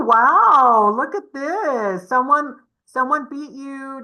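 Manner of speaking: normal talking voice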